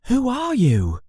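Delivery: put-on a puzzled boy asking; young Harry's voice. Harry asking the giant who he is, boyish voice.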